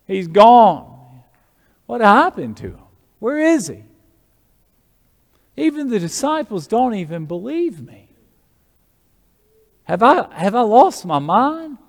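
acted high-pitched, bewildered questions; distraught Mary Magdalene. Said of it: "Look at the emotions there. She's crying".

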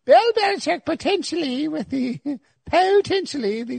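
high, slow, hammed-up English accent; old English woman. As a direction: play an old English lady announcing it. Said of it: "he's an old English woman".

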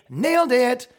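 high, put-on smug voice; mock triumphant boast. Campaign maker reporting to their boss, mock triumph.

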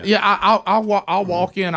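ordinary talk

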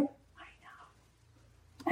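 barely audible whisper; whispered reassurance. Retold or imitated. Spielberg answers the tearful Oprah, whispered reassurance. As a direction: whisper it as if comforting someone in tears. Director comforts the crying actress.

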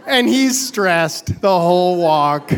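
ordinary delivery

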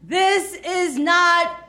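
high, slow, drawn-out disbelief; high-pitched, slow-motion panic; mock horror. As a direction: stretch each word in hammed-up dread. Retold horrified thought at the airport.

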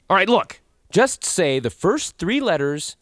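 exasperated. Acted. Host fed up with the Canadian voice, exasperated. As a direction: lose patience with the stubborn teacher.

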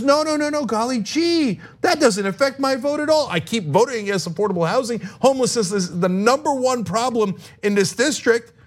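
high, innocent gee-whiz voice; wholesome 1950s kid. Retold or imitated. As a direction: play it like a naive 1950s kid, golly. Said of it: "And she says".

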